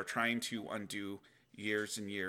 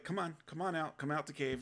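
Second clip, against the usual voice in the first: higher coaxing call; lightly voiced patient savior inviting out; gentle Jesus; 6 semitones higher than usual. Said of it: "Jesus might call you out again".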